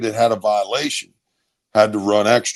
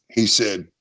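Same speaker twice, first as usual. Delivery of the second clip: normal talking voice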